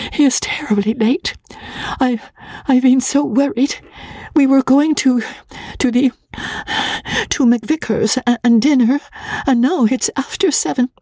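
high, breathless stammering plea; nervous bride asking the clerk for help; timid young wife. A narrator's reading. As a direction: stammer it out, half whispered. Magnolia's voice held.

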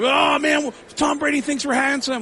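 high-pitched gushing; mock giddy delight. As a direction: retell it as a thrilled squeal of words. Retelling his reaction to a ranking.

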